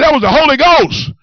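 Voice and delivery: high-pitched, emphatic zeal; acting out a convert's excitement; exclaimed answer. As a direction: announce it as glorious news. Retold excited answer to counselor.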